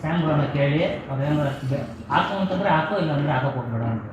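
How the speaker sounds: heated argument. Real, not acted. Host defending his father to the guests, loud and heated. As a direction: argue it out loudly over the others.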